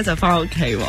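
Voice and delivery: ordinary talk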